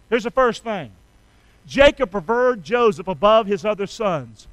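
ordinary delivery

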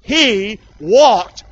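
slow and loud, drawn out; slow unison recitation. Shown as a demonstration. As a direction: lead them through each word. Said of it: "say it with me".

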